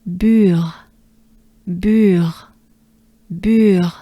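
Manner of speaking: "Bure" drawn out, breathy French R ending. Shown as a demonstration. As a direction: join rounded u to breathed R in "Bure". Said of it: "French R, re, just a breath".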